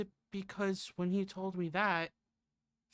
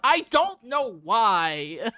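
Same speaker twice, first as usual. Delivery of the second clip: high, loud whiny imp voice; 3 semitones higher, 10 dB louder, much slower than usual. Shown as a demonstration. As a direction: pitch it up and whine. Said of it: "pitch my voice up and just put on my biggest whininess".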